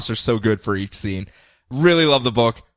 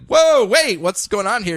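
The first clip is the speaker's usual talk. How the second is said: startled; 8 semitones higher than usual. Retold or imitated. Apollo startled as his partner heads for the door. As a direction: jump as he catches on. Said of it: "And Apollo's like".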